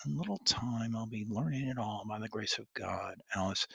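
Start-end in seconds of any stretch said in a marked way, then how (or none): none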